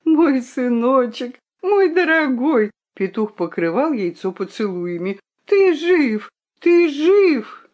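high, joyful cries; a rooster overjoyed over his chick; overjoyed father. Acted. Father greets hatching chick.

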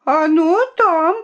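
high, loud, drawn-out call; crying out. A narrator's reading. Violinka shouts where the egg is, crying out. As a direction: call it out slowly, pitch up. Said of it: "крикнула Виолинка".

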